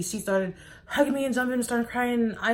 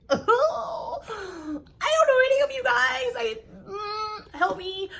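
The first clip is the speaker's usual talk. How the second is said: very high, slow mock-crying wail; overwhelmed wail; slower than usual. Learning of more unknown siblings, high mock-tearful wail. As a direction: whine it like a comic plea for rescue.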